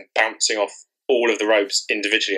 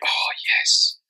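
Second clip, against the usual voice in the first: whispered. Retold or imitated. Blissful reaction to perfect numbers.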